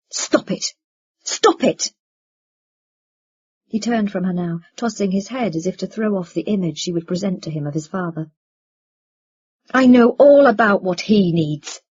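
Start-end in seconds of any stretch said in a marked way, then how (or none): 0.1-1.9 sharp outburst, louder on the repeat; angry interruption. A narrator's reading. Son cuts off mother defending father, angry interruption. 9.7-11.8 loud, forceful retort; angry retort. A narrator's reading. Son rejects excuses for his father, angry retort.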